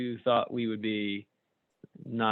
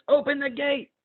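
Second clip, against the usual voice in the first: excited squire; 12 semitones higher than usual. Acted. Squire voiced in comic strip.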